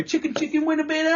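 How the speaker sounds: very high, soft squeaky imitation; high mock quip; friend's little quip. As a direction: pipe it up like a cartoon mate. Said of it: "you do your little quips".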